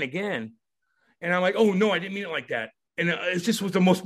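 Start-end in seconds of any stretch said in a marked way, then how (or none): 1.6-2.7 higher, hasty mock reassurance; apologetic backpedal. Player to crying billets, apologetic backpedal. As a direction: take it back, lightly acted.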